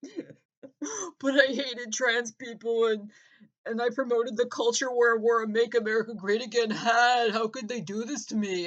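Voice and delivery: fake-sob through the lines; mock crying. Comic mocking a tattooed man, mock crying. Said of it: "Guy Revere crying".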